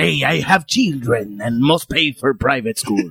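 Antonio Banderas impression. Actor explaining the ad job, slow mock Spanish accent. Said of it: "that was him".

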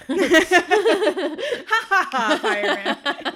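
high, loud laughing through the word; can't stop laughing. Real, not acted. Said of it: "I can't laugh".

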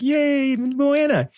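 hammed-up excitement for Moana; mock excited cheer. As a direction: sell Moana with a bright yay. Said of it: "And I said".

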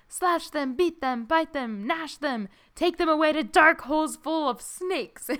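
a lightly voiced dramatic goblin tyrant; dramatic goblin king. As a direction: ham up the goblin's bloodthirsty commands. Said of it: "the Great Goblin shouted".

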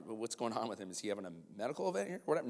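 quick, low aside to himself; softly spoken retold thinking; hushed inner thought. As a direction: murmur a puzzled private thought.

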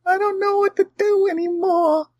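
tiny, very high falsetto squeal; despairing little voice. Pretend. Voicing Georgie stuck on the truck, helpless falsetto wail. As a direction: squeak it out far above your own voice.

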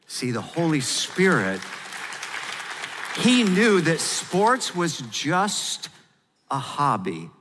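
rising preacher's fervor; preaching. Acted. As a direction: preach it with building fire. Preacher building intensity.

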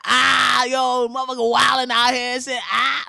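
loud street-talking Obama. Retold or imitated. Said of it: "Barack low-key be like".

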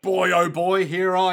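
loud, eager hammed-up relish; big, boisterous acted anticipation; gleeful villain. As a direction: announce the attack with mock glee. Acting goon eager to kill.

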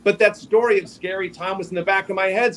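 ordinary talk, nothing marked

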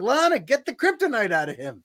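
high-pitched, exasperated urging, no real volume; mild retold shout at the screen; mock yell at TV. Fan shouting at the TV for Lana to act, mock yell. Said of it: "I was shouting at my T V".

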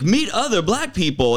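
a touch of jazzy commercial pitch; jazzy radio announcer. Retold or imitated. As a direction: imitate a radio ad's jazzy sell. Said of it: "they always talked".

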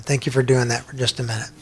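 usual speaking voice

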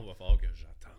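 hammed-up loud complaint. Shown as a demonstration. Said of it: "Oh non".

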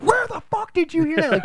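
high, loud shriek of a shocked mother; mom flipping out. Retold or imitated. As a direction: blow up like an outraged mom, hammed-up. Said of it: "flips out".

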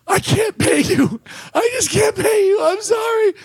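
high, whining mock-crying voice; tearful. Crying promoter apologizing to the unpaid comic, mock tearful whine. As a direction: wail the apology, hammed-up and high. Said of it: "and starts crying".

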